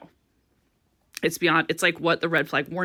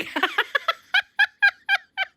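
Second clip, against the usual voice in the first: a squealing, very high laugh; high giggle. Real, not acted. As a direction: let a giddy laugh run away. Laughing retelling Erica's comment.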